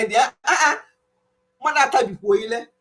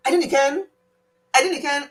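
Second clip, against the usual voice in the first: higher, imitated hurried Scottish reply; quick brush-off; 6 semitones higher, 3 dB louder than usual. Locals answering her question while walking away, imitated quick brush-off. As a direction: toss it off quickly, pitched up. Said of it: "they'll be so quick".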